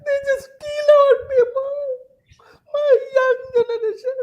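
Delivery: high, tearful, breaking voice; crying. Real, not acted. Panelist mourning the killed young generation, weeping. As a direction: speak through real tears. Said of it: "I'm very emotional now".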